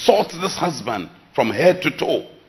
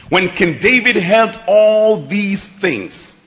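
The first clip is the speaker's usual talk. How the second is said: solemn scripture reading; 5 semitones higher, 5 dB louder, slower than usual. Acted.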